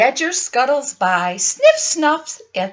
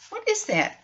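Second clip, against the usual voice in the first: hushed repeat; 13 semitones higher, 5 dB quieter than usual. Pretend.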